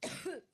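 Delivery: harsh dry cough; coughing fit. Real, not acted. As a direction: cough hard, can't stop. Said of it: "my allergic reaction".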